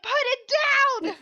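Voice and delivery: high, urgent imitated shout; retold yelling. Teacher retells ordering child to drop mouse, imitated yell. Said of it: "We were yelling".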